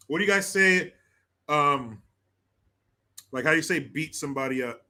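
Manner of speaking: ordinary talk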